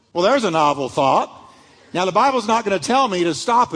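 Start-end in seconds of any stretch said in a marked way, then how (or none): none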